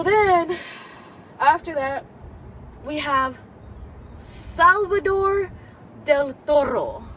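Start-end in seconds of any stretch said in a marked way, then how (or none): 4.2-7.0 slow, careful name announcement; drawn-out, carefully paced Salvador Del Toro. Real, not acted. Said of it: "I have to say his name so slow".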